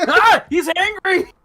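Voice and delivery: high, loud alarmed yell; mock panic. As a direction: shriek in fake fright. Poked creature reacts.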